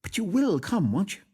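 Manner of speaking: eager coaxing plea; eager, high pleading. A narrator's reading. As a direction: urge him to come, eagerly. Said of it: "laying her warm hand eagerly".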